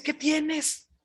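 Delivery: a wife pretending tender concern, high and hushed; mock sweet wife. Jezabel asks sulking king Acab, mock-sweet high voice. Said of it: "Ya ves cómo son las mujeres muy amables para preguntar".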